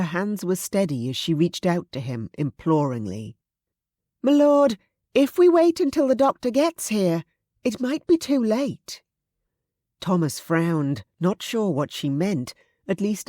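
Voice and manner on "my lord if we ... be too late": high, loud, pleading urgency; urgent maid. Acted. Susan urging Thomas not to wait, urgent. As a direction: press him to act now.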